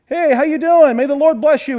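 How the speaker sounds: breezy, high-pitched casual hello; cheerful greeting. Acted. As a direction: greet them cheerily, as if nothing is wrong. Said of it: "Cheerfully, like".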